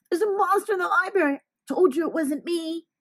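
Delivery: put-on a high, fast kid's voice; small boy shouting the news; excited boy. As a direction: blurt it out like an alarmed little boy. Boy voice announcing the monster.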